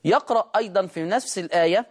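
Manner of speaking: ordinary delivery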